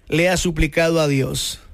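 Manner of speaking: usual speaking voice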